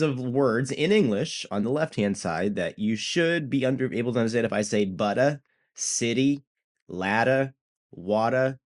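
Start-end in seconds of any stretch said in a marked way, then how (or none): 4.8-8.6 New England word list, dropped Rs, tapped Ts; New England accent. Shown as a demonstration. Teacher lists English words on screen, New England accent. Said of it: "my New England Family Guy accent".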